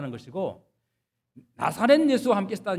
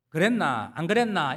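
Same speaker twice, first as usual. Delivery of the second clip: 경상도 사투리로; Gyeongsang dialect. Retold or imitated. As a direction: speak it like a Jinju country boy. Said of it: "이렇게 말하다가 서울 가가지고는".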